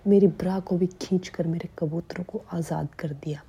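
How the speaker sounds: usual speaking voice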